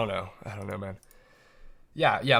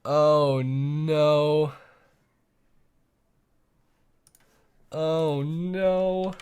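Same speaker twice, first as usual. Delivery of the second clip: long drawn-out "oh no"; mock despair; 4 dB louder, much slower than usual. Streamer watching the stream fail, drawn-out mock-despairing moan. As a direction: moan it out in hammed-up dismay.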